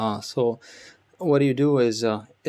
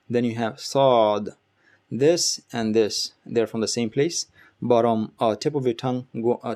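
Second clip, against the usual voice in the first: usual speaking voice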